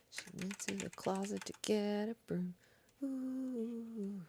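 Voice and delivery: hushed ghost-story telling with soft moans; spooky story voice. Acted.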